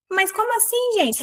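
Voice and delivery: high, incredulous gasp of a question; mock disbelief. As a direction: act shocked at the gossip. Fingindo espanto com a frase. (espanto fingido, bem agudo)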